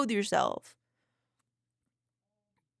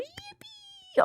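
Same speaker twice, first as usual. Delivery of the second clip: high-pitched drawn-out yippee; excited cheer; 4 dB quieter than usual. Real, not acted. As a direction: squeal it in celebration. Cheering a viewer's good news.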